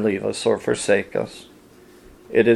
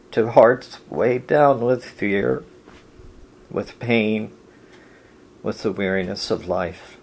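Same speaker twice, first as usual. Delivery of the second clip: ordinary talk, nothing marked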